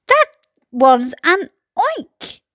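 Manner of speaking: slow, halting alarm; understated storybook scream; mild mock scream. A narrator's reading. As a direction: point out the monster in dread, word by word. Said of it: "screamed the head of the fire department".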